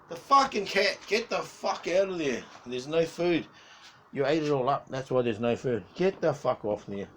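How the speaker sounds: real loud scolding of a cat; exasperated, loud telling-off; angry yelling. Real, not acted. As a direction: yell at a misbehaving pet to get off.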